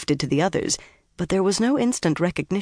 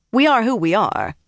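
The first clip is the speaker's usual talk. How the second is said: higher-pitched, crisp assertion; 6 semitones higher, 3 dB louder than usual. A narrator's reading. Liana insists on who they are to doubters, crisply. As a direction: say it crisply and firmly. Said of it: "Liana said crisply".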